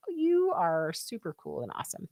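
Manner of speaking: ordinary delivery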